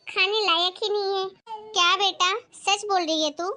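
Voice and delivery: normal talking voice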